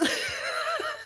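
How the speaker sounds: very high shrieking giggle; squealing laugh. Real, not acted. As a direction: squeal with glee. Laughing at her own retort.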